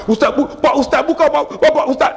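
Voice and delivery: a pleading Indonesian man calling out; urgent Indonesian caller. Retold or imitated. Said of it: "seorang Indonesia". (suara orang Indonesia, tinggi mendesak)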